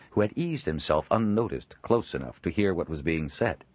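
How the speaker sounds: usual speaking voice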